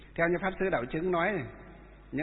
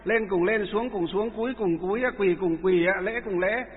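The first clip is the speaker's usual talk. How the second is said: rhythmic ritual calls; 3 semitones higher than usual. Shown as a demonstration. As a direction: call the bows out in rhythm for the group. Demonstrating group prostration calls. (hô nhịp lễ lạy to và đều)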